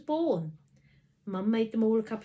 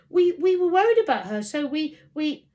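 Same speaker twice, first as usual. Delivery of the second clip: nervous stammer; 8 semitones higher, 13 dB louder than usual. A narrator's reading. Boy stammers nervous excuse.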